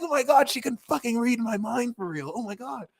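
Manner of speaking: scared friend reacting to a mind reader; imitating freaked-out people. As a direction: act like a spooked friend. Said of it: "They're like".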